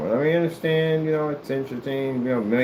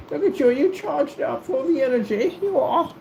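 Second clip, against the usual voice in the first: high-pitched cooing voice; cooing praise; 14 semitones higher than usual. Pretend. Talking to something all charged up, high coo. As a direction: gush in a high baby-talk pitch.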